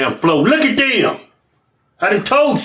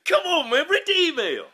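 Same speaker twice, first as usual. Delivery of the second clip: high-pitched, softer exasperated urging; exasperated plea; 13 semitones higher, 5 dB quieter than usual. Real, not acted. To the stalling co-host about the email, exasperated plea. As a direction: plead up high to get things moving.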